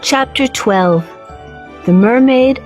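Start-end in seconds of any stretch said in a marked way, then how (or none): none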